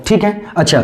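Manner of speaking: ordinary delivery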